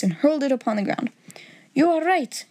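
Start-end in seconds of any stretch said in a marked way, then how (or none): 1.7-2.4 mild outcry, pitched higher; crying out. A narrator's reading. Unmasked king admitting the truth, a mild high-pitched cry. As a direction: blurt out the admission at normal volume. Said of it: "he cried".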